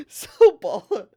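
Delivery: high, loud delighted squeal; excited squeal. Real, not acted. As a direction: squeal with glee. Thrilled by the insult.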